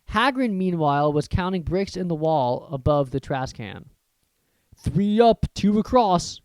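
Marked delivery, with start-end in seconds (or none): none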